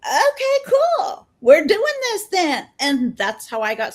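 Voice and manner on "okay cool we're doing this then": excited. Retold or imitated. Her excited decision.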